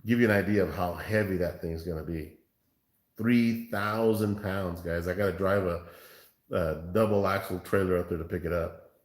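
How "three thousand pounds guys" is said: "three thousand pounds" drawn out slowly; slow emphasis. Real, not acted. Repeats the weight for effect.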